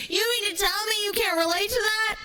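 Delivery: ordinary talk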